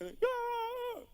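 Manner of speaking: put-on high, slow, stretched child's cry; hammed-up little girl's sob; mock sobbing wail. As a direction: throw yourself down and wail like a crying kid. Daughter flops on bed crying.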